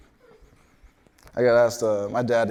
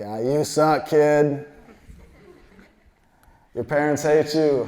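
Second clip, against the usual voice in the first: raised, drawn-out jeer from the stands; heckling; 5 semitones higher, 6 dB louder, slower than usual. Retold or imitated. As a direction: imitate a dad heckling a kid, loud and slow. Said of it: "he was heckling me".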